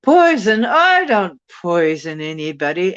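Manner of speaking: mock British accent from a loudspeaker; imitating British accent. A woman on a loudspeaker denies poisoning, in a British accent. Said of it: "she's saying it in, like, a British accent".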